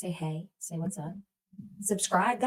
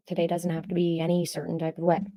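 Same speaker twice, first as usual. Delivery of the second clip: ordinary delivery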